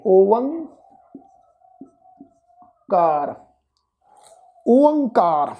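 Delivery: ਓਅੰਕਾਰ sounded out as o-ang-kaar, rising then falling; pronunciation demo. Shown as a demonstration. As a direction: stretch each part of ਓਅੰਕਾਰ. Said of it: "ਇਹਦਾ ਉਚਾਰਨ ਬਣੇਗਾ". (ਓਅੰਕਾਰ ਦਾ ਲਮਕਾ ਕੇ ਉਚਾਰਨ)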